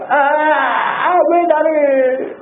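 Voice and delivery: high, slow, drawn-out mock groan; mock groaning fall. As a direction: moan it like someone who just fell down. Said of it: "이렇게 되니까". (넘어져서 길게 신음하는 사람 흉내)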